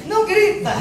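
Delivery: loud, high shout of don't shout; self-mocking shouted command. Shown as a demonstration. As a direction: yell it at the kids like a frantic parent. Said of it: "Eu tô falando de mim aqui".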